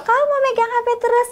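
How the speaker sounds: high-pitched acted parental scolding; orang tua mengomel. Parent scolding child, voiced. (omelan orang tua bernada tinggi)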